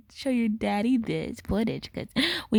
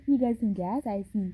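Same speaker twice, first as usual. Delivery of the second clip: ordinary talk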